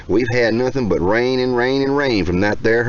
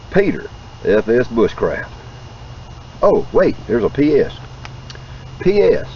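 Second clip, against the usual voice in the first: ordinary talk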